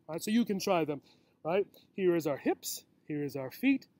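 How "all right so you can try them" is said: ordinary delivery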